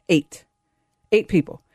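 Eight said alone, low and final, then Eight people; emphatic repeat. Real, not acted. Host hammers home the mask count to listeners, stressing Eight. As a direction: punch Eight as a flat verdict.

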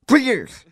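Three years punched high and loud; stress on Three years. Acted. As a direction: hit "Three years" hard and indignant. Caller stressing outrage.

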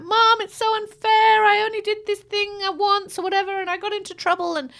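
mock sobbing daughter protest; whiny upset daughter. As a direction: mimic a teary kid complaining it's unfair. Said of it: "She was crying and she's like".

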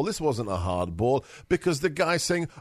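ordinary talk

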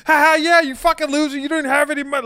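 high, loud jeering put-on voice; exaggerated high-pitched laughing taunt; mocking Maddie impression. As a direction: dress the insult up in a high, jokey cackle. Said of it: "in this bullshit Maddie fucking voice".

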